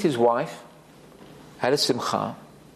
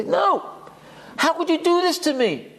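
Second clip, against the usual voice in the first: hurt protest; 9 semitones higher than usual. Acted. Acted hurt spouse refusing forgiveness.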